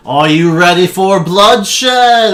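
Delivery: magically amplified announcer roaring to the arena; booming announcer. Acted. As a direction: boom it out over the crowd like a magically amplified voice. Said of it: "his voice is kind of, um, augmented".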